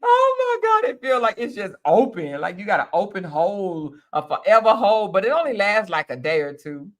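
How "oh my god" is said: a high squealing burst of laughter; laughing shriek. Real, not acted. Reading a wild comment, laughing shriek. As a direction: shriek it out while cracking up.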